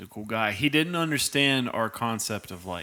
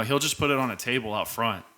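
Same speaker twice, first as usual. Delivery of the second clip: ordinary delivery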